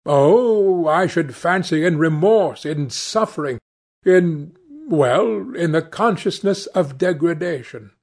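earnest, hesitant painter. A narrator's reading. Painter answers, searching for words.

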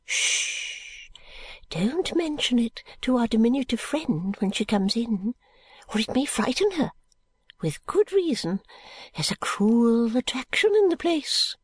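put-on high, secretive old lady, dropping to a hush; mysterious. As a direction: lure him in with a secret. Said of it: "in her mysterious way".

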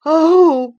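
loud, high sobbing Oh; sobbing cry. A narrator's reading. Linton sobbing on the ground before Catherine. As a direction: break into a sob of terror. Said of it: "he sobbed".

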